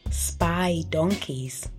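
usual speaking voice

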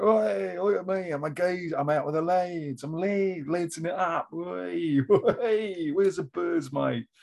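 high-pitched caricature of a London lad; London geezer accent. Retold or imitated. London lads interrupting the compere, caricatured geezer accent. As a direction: heckle in a hammed-up high Cockney voice. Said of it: "they're like".

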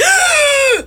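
sharp high gasp, almost a shout; loud shocked gasp. Retold or imitated. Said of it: "and she was like".

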